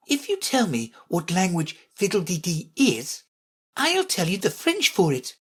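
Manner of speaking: high voice, understated triumph; spring the clever answer in a high voice; triumphant. A narrator's reading. Alice outwitting the Red Queen, triumphant. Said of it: "she exclaimed triumphantly".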